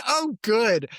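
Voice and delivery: high, loud sarcastic quote; hammed-up sarcastic relief; mock sarcasm. Teasing her co-host by quoting her sarcastic remark. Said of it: "And you were like".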